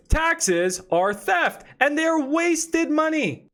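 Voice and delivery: very slow, each word punched; deadpan slow declaration; emphatic. Real, not acted. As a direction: spell out the grievance slowly. Ranting about taxes.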